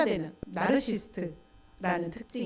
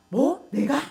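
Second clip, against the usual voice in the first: mock indignant retort; 3 semitones higher, 4 dB louder than usual. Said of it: "막 이러면서 화를 내죠".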